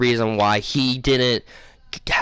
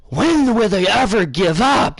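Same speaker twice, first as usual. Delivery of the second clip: high, drawn-out exasperated complaint; 5 semitones higher, slower than usual. Retold or imitated. Storyteller reacts to Fire Nation attacking again, exasperated. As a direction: complain slowly, pitch up, fed up. Retold outburst as enemies reappear.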